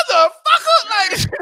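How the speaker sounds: high, loud, slow laughing yell; laughing shout. Real, not acted. Retelling the old man's complaint, laughing loud shout.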